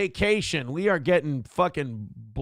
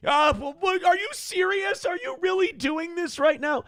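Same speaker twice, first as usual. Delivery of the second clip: high, shrill-pitched mock outrage; mock outraged senator; 14 semitones higher than usual. Mocking senators who get offended at a comeback, high mock outrage. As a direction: squeal like a pearl-clutching politician. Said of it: "the minute I just flick you back".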